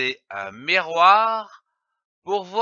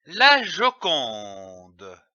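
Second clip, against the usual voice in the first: la Joconde drawn out slowly and lower; slow and clear; 6 semitones lower, much slower than usual. Shown as a demonstration. Teacher to pupils, slow clear model word.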